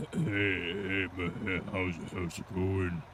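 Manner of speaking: low, put-on deep gamer voice. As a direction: drop the voice low and gravelly. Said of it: "they try to act like they're, like, [name]".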